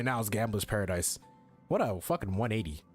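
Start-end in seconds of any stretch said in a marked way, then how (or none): none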